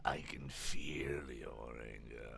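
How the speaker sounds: very low, slow, whispery villain; Palpatine. Acted. Evil emperor goads his enemy, whispered villain impression. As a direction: whisper it slowly and darkly. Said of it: "okay, Emperor Palpatine".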